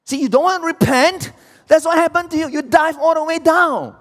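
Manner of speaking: high, loud, hammering sermon voice; full-force preaching. Acted. Preacher warns sinners who won't repent, loud and high. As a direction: drive the warning home.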